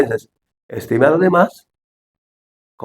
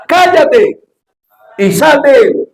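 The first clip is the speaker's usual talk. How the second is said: high, loud commanding shout; stern rebuke; 10 semitones higher, 4 dB louder, much slower than usual. Acted. Jesus rebuking the demon, loud commanding shout. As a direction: cast the demon out with authority. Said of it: "Lo reprendió diciendo".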